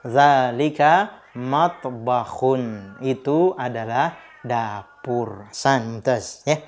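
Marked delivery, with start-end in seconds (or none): 0.1-2.9 zalika matbakhun said very slowly and clearly; teacher modelling the answer slowly; slow model answer. Shown as a demonstration. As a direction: drill the phrase slowly for children. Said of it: "Disandingkan dengan zalika".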